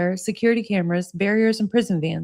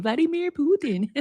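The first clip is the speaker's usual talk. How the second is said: Vladimir Putin in a mock Russian accent; 5 semitones higher than usual. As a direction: do Steven Seagal's Russian accent. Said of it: "Steven Seagal saying".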